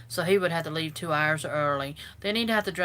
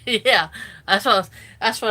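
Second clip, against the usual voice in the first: words stretched by a big yawn; 4 semitones higher, 11 dB louder than usual. Real, not acted. Yawning while chatting to her viewers on camera. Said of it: "I'm sorry about the yawning".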